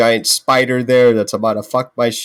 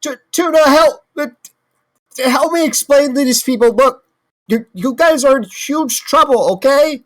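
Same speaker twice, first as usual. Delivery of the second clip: high-pitched, urgent explaining; flustered pleading; 4 dB louder than usual. Acted. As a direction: try to reason with them, voice way up. Pleading with kobolds in character.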